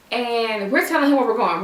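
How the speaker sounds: ordinary talk, nothing marked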